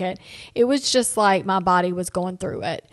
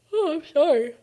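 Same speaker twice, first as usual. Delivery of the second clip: talking through a real yawn, higher pitch; 8 semitones higher than usual. Real, not acted. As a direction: apologise mid-yawn. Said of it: "I keep yawning".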